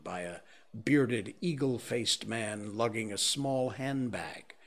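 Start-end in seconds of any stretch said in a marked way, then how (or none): none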